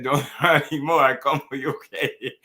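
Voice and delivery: laughing through a mock Cuban accent; light-hearted copycat Cuban accent, breaking into laughter; joking Cuban accent. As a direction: playfully imitate the fighter's Cuban accent. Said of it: "I'm just joking with the accent".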